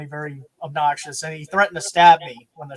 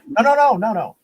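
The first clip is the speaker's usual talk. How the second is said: sharp, loud, higher no's; emphatic refusal; 4 semitones higher, 7 dB louder than usual. Retold or imitated. Promoter cutting off the new announcer, loud insistence. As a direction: cut him off hard.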